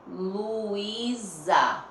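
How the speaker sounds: "Luísa" very slow, the S a long Z; Z sound shown. Shown as a demonstration. Teacher telling pupils to hear the word, drawn out. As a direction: stretch "Luísa" syllable by syllable. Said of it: "Olha o som da palavra".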